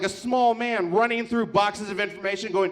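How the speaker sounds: ordinary talk, nothing marked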